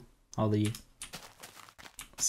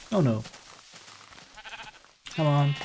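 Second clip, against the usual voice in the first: ordinary talk, nothing marked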